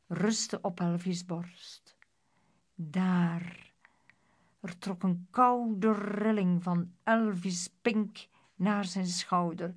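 ordinary delivery

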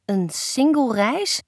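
slow, rising echo of single reis; questioning single reis. Acted. Daughter querying her parents' gift, single reis as a puzzled question.

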